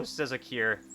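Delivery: normal talking voice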